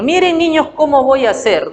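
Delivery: put-on high, bright call to little kids; teacher calling her class; preschool teacher. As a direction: show the class, teacher to children. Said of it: "Al decir, por ejemplo".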